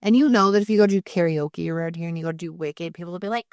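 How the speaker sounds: ordinary delivery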